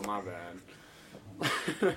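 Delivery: very slow, put-on mom voice; mock mom apology. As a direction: drag out mom's sheepish apology. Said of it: "she was like".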